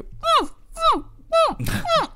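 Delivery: high closed-mouth humming; muffled kid hum. Retold or imitated. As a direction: hum like mouth glued shut. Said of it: "The kid trying to go".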